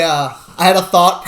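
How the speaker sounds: usual speaking voice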